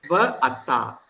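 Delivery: ordinary delivery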